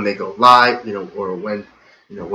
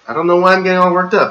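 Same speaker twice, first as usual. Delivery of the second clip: worked up; 8 semitones higher, 4 dB louder, much faster than usual. Real, not acted.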